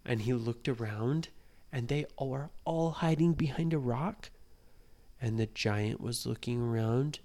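plain reading voice

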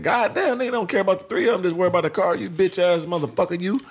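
high-pitched, fast-starting indignant rant; retold indignant outburst. Retelling his reply about the car, high indignant outburst.

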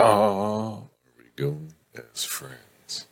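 very slow, drawn-out suspenseful reread; mock melodrama. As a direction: stretch the question out for mock drama. Re-read after dramatic sting.

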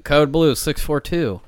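loud, slow school PA voice; intercom announcement. Retold or imitated. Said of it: "this noise came over the, uh, fucking microphone".

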